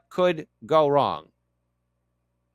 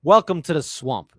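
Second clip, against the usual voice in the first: louder trailer tagline with only a hint of Scottish; Sean Connery attempt; 3 semitones higher, 5 dB louder than usual. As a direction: announce it like a movie title, half-hearted Scottish. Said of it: "I can't do a Sean Connery accent".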